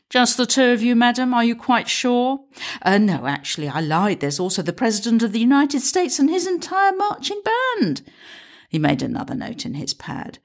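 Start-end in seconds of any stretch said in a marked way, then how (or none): 0.1-2.4 higher, polite doubting officer; doubtful constable. Acted. PCSO, higher. 2.8-8.0 sarcastic. Acted. Sarcastic reply to officer.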